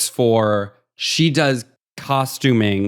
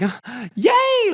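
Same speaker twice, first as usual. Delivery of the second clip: high squealed yay, imitating her joy; mock excited cheer. Woman winning at slot machine, high excited squeal. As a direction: squeal it like a winner at the slots. She wins at the slots.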